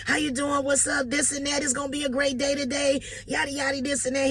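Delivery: high-pitched, over-bright morning hello; a caller's high, eager good morning; loud cheery greeting. Retold or imitated. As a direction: pump up a sunny wake-up call. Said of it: "calling him all loud".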